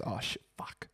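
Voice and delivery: whispered curse, caught out; whispered panic. Retold or imitated. Mimicking the bloke realising his mistake, whispered. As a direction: breathe it like he knows he's stuffed. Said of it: "He goes".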